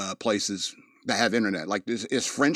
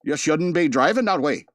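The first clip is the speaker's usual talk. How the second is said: raised, emphatic Wisconsin officer imitation; Wisconsin cop accent; 5 semitones higher, 4 dB louder than usual. Body cam officer making a drunk-driving arrest, Wisconsin accent. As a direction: play a Wisconsin policeman with a broad accent. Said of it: "body cam videos from La Crosse, Wisconsin".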